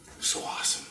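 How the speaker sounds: whispered in awe; awed whisper. Real, not acted. Storyteller marvelling at the boy's carving, whispered. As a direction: breathe it out, hushed wonder.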